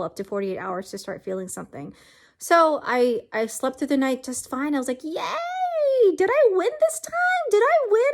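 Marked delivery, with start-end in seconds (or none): 5.0-8.1 squealed high hopeful cheer; a giddy little victory squeal; excited. Retold or imitated. To herself after sleeping fine, high giddy cheer.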